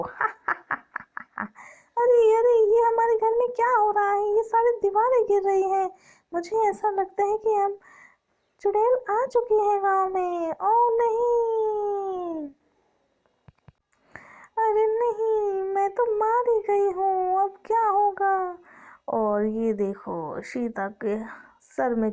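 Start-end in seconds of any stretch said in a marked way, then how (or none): 0.0-1.4 a high laugh sliding into breathy fade; gleeful witch cackle dying out. Acted. 2.0-18.6 a villager screaming as walls fall; panicked villager. Acted.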